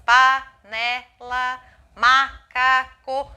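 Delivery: slow, loud, syllable by syllable: pa-ne-la, ma-ca-co; split into syllables. Shown as a demonstration. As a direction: tap out each syllable of panela and macaco slowly. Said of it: "Separar palavras em sílabas". (separando as sílabas devagar)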